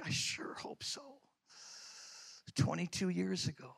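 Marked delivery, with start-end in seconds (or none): 0.0-1.2 breathy hopeful whisper. Retold or imitated. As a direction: whisper it back, almost to yourself. Answering God's whisper.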